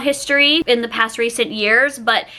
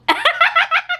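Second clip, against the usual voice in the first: high laugh. Real, not acted. Bursts out laughing mid-story.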